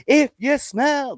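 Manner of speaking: drawn-out high catchphrase, slow; hammed-up wrestling catchphrase, very slow; Rock catchphrase. Host mocks a wrestler's catchphrase, high and slow. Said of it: "famous catchphrase".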